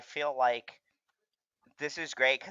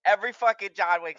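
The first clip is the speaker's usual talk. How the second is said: ordinary talk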